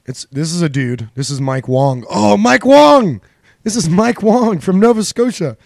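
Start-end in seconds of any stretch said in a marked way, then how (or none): 2.1-5.6 much higher, louder, slow mock excitement; excited. Real, not acted. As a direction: savour the name with glee. Excited naming the lawyer.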